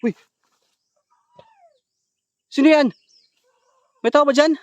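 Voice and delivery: high, loud call into the dark. Real, not acted. Streamer calling into the dark room, loud and high. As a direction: call out to whoever is hiding there.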